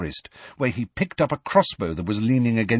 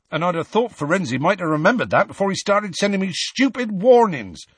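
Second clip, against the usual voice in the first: raised, indignant rustic voice; hotly; 10 semitones higher, 4 dB louder than usual. A narrator's reading. Hagrid complains about Firenze, hotly. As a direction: build to a loud, resentful finish. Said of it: "he added hotly and unexpectedly".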